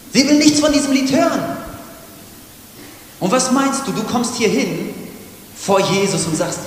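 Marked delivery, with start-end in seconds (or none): 0.1-1.4 "Sie will nichts von diesem Lied hören" much higher and louder; loud emphatic repeat. Shown as a demonstration. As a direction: repeat it shouting the point.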